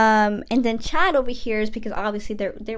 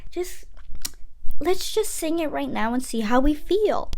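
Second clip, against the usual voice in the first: coaxing Gabriella; 4 semitones higher than usual. Retold or imitated. Gabriella coaxing Troy, higher voice.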